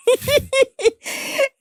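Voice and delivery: a high, delighted laugh; real laughter breaking out; high burst of laughter. Real, not acted. Laughing after the punchline.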